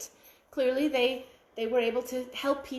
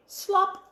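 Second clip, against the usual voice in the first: high, punchy splat word; bright sound-effect slop; 8 semitones higher than usual. A narrator's reading. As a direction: pop it out like a splash.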